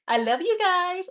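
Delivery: high, drawn-out gushing fondness; squishy affection. Real, not acted. Streamer tells her live viewers she loves them, gushing. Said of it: "Oh, we all squishy now".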